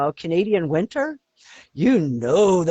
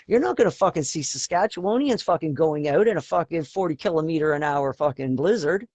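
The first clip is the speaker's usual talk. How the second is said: ordinary talk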